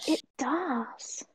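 breathy whispered aside; whispering. Real, not acted. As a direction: whisper it under the talk. Quiet aside during guest's thanks.

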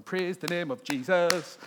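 loud, slow hammed-up hallelujah voice; mock jubilant praise. Pastor mocking forced worship, loud hammed-up praise. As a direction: belt it out like forced cheer. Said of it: "that you're not going".

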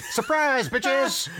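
high-pitched, hammed-up triumphant cry; spring the big reveal in a high mock yell; Twilight Sparkle reveal. Pony throwing off a disguise to the villains, high mock-gleeful cry. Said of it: "and she goes like".

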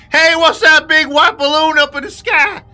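loud, high hillbilly yell; hammed-up country bumpkin shouting at the sky. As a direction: ham up a yokel spotting a balloon. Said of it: "They don't talk like that in Montana".

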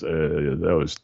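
a hint of a mentor's Dutch accent, kept gentle. Mentor pulling him aside to correct him, light Dutch accent. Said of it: "you know, his Dutch accent".

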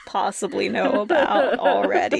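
giggling through the words; laughing. Real, not acted. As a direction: say it while laughing nervously. Laughing through content warning.

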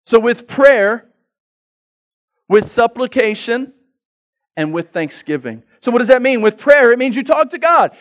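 loud, high, fervent sermon and prayer; preaching at full force. Acted. Pastor modelling a prayer to God for the church, fervent. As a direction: pour it out as an earnest prayer.

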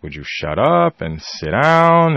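retold scolding. Mother to ranting child, exasperated scolding. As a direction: imitate a fed-up parent laying down the law. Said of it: "lit my ass up".

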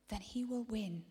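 hushed, urgent whispering warning; intense whisper. Acted. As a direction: whisper it like a dark secret.

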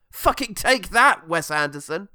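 loud, high mock gloating; mock triumphant. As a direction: crow over a landed blow. Mock gloating after savage review.

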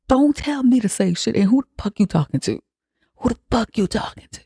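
high-pitched indignant retort; mock-furious pushback, no shouting; acted losing it. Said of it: "She lost her fucking shit".

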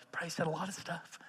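fast, whispered throwaway reply; mumbled whisper. Acted. Elders answering Peter, a fast whispered mumble. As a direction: mumble it off under your breath.

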